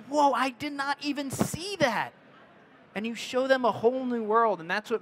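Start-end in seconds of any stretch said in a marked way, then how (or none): 0.1-2.1 high, astonished gasp of a viewer; mock-amazed whoa; amazed onlooker. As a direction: play a viewer stunned by the photo. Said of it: "people are like".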